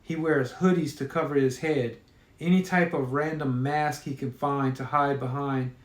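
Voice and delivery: ordinary delivery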